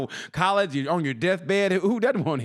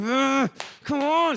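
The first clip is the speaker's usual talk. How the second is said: slow, pitched-up come on; drawn-out plea; 9 semitones higher, much slower than usual. Retold or imitated. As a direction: stretch it out, begging. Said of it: "I'm like".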